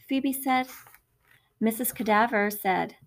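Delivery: usual speaking voice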